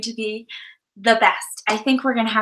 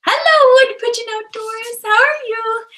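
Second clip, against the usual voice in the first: excited greeting; 12 semitones higher, 5 dB louder than usual. Real, not acted. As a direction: sing out a high, delighted greeting to a viewer. Greeting a viewer on stream.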